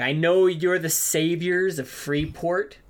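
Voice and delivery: low, slow, quiet rebuke; a guard lecturing the saviors of Freeport; slow low scolding guard; stern guard. Acted. Guard NPC scolds the heroes.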